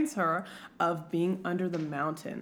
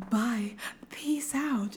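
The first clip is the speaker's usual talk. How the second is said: hushed quick exit; 2 semitones higher, much slower than usual. Acted. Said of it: "Ianthe's like".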